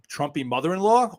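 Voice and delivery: ordinary talk, nothing marked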